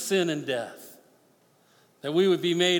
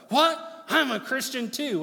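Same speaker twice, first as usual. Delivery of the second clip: indignant surprise; 9 semitones higher than usual. Retold or imitated. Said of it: "He's like".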